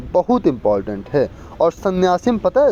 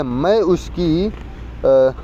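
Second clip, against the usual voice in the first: slow reading; much slower than usual. Shown as a demonstration. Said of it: "दिए गए वाक्य में किस भाग में त्रुटि है?".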